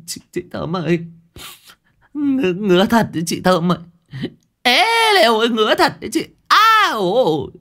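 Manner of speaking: put-on whining itchy boy. Tưởng begs Thơm about the itch, high whining. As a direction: whine like a boy who can't stop scratching.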